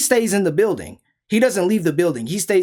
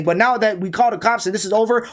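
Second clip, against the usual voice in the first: ordinary talk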